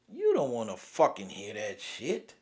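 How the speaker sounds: ordinary talk, nothing marked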